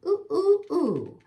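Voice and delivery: monkey hoot. Acted. Monkey hoots.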